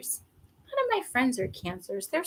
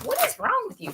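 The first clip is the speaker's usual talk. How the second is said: exasperated; 12 semitones higher, faster than usual. Retold or imitated. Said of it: "I'm like".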